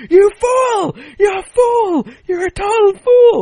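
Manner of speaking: high shrieking "You fool!" yells; mock screaming. Host screams at the contestants who got it wrong, shrieking high. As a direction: scream it in a high, loud put-on voice. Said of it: "he keeps just screaming at them".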